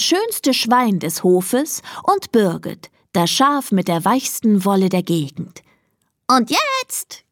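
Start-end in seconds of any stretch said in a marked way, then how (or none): 6.3-7.2 very high swooping crow; crowing rooster. A narrator's reading. Rooster Konrad announces the next contestant, crowing high. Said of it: "krähte Konrad".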